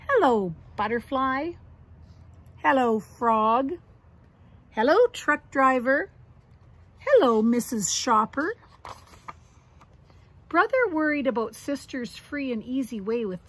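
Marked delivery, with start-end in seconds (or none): 0.1-8.5 cheery greetings. Acted. Sister greets everyone cheerfully.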